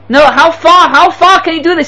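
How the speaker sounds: high, urgent acted plea; distressed protest. Students to their tortured rebbe, distressed protest.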